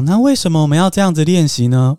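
ordinary talk, nothing marked

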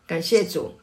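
ordinary talk, nothing marked

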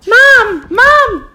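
put-on high falsetto girl calling loudly; man doing a woman's voice. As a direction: shout for mom like a little girl. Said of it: "Another little girl sound".

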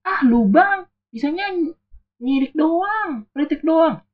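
high, whiny mock jeer; mimicking a nagging netizen, high and mocking. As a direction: sneer it like a snide online troll. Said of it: "netizen bilang gini".

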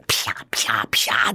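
slow, breathy slap noises; mimicking slaps in a hushed voice; whispered slap sounds. Imitating slaps heard at night.

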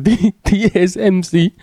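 a high, excited Korean backpacker shout; imitating a Korean tourist. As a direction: squeal it like an eager Korean tourist. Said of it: "他跟我讲".

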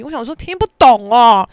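ordinary delivery

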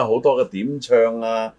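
ordinary delivery

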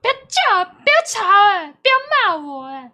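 put-on a bratty kid telling relatives to stop scolding. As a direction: snap back like a spoiled child. Acting annoyed bratty kid.

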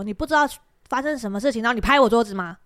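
loud, indignant retold comeback; fierce retort. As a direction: snap back at the boss, punching the accusation. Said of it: "然后我就这样凶他".

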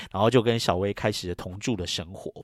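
normal talking voice

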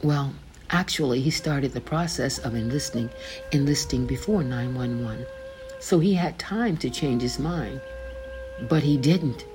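usual speaking voice

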